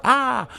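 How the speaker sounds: very high ah. Shown as a demonstration. Showing the mouth sound, high ah. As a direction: sing out a high ah. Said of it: "when I would go".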